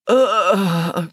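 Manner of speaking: long, exasperated groan; weary groan. A narrator's reading. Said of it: "groaned Mary".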